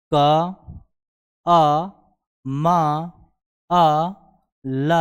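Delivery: each sound क, अ, म, अ, ल said alone with a pause after it; name the letters one by one, crisply. Shown as a demonstration. Teacher to students, sounding out each letter separately. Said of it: "वो ध्वनि क्या क्या है".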